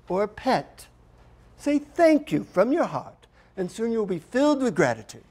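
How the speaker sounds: high, measured voice of a teaching guru; guru instructing. Retold or imitated. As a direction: stretch the key words as patient instruction. Guru teaches the heartfelt thank you.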